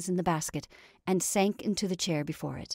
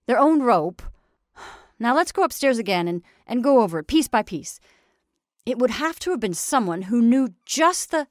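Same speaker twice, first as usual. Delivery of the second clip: loud, businesslike official, pitch raised; resuming interrupted conversation; 5 semitones higher, 10 dB louder than usual. A narrator's reading. Attorney resumes his talk with the sheriff, businesslike. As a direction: pick up loudly mid-conversation. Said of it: "in the manner of continuing an interrupted conversation".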